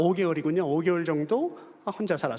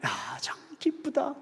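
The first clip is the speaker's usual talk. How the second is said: quiet inner joy; 7 semitones higher, 5 dB quieter, slower than usual. Retold or imitated.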